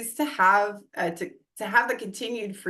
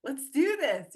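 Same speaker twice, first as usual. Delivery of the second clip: nervous excited push; 6 semitones higher than usual. Retold or imitated. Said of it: "It was like".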